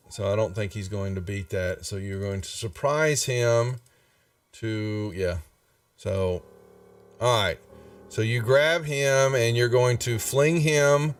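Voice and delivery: usual speaking voice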